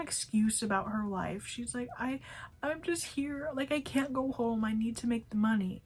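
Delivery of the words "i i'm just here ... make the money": higher-pitched mock-upset pleading; tearful complaining, imitated. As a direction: imitate a coworker pleading through tears. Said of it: "started crying and complaining".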